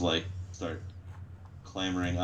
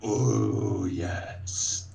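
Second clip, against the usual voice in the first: slow, whispery ogre voice; slow bloodthirsty creature; much slower than usual. Acted. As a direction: draw it out with menace, half whispered. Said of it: "The ogre looks at the captain and says".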